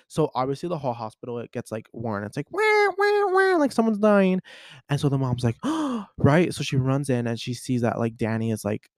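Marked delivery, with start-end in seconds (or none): 2.5-3.6 high, wailing "wah" like a siren; imitated alarm. Movie fan imitating the hospital alarm, high wailing siren noise. 5.6-6.1 sharp, acted gasp; shocked gasp. Movie fan acting out the shocked mom, sharp gasp. Acts the mom's shocked reaction.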